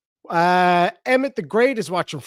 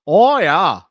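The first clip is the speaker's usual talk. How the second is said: second "Oh, yeah" in mock Minnesota, pushed louder; Minnesota accent; 4 semitones higher, 9 dB louder than usual. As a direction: lean harder into the Minnesota "Oh, yeah". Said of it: "They all talk like that".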